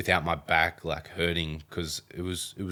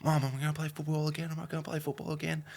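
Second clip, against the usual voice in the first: higher, fast, upset plea to mum; a scared kid begging his mum for an answer; rushed, tearful repeated question; teary plea; 10 semitones higher, 3 dB quieter, faster than usual. Retold or imitated. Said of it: "I'm getting teary".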